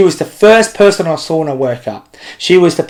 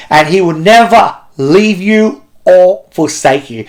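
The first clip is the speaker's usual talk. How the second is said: slow, weighted promise on never; slow emphatic quote; 3 semitones higher, slower than usual. Acted. Preacher driving home the verse to the kids, slow and weighted. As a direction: lean into never, slowly.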